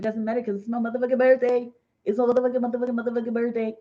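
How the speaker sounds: fast, loud birthday boast; rapid-fire, tipsy exuberance; celebrating. Real, not acted. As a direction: rattle it off in a celebratory chant.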